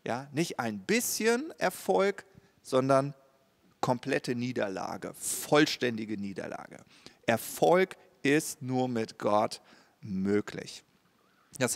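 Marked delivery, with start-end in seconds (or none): none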